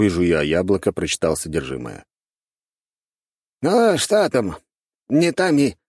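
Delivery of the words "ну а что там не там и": high-pitched grumbling elder; lightly grumbling old man. A narrator's reading. Grey-bearded elder asks about the note, grumbling. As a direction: grumble in a raised old man's voice. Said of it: "проворчал седобородый старик".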